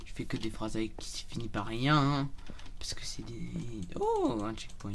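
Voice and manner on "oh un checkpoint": high delighted discovery; sudden pitch jump of joy; excited. Real, not acted. Spots a checkpoint in game.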